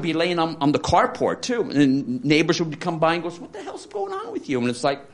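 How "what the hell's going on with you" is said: very high, laughing nosy neighbor voice; hammed-up high neighbor voice, softer; mocking neighbor. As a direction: squeak it like a prying neighbor through laughter. Neighbors passing by, imitated.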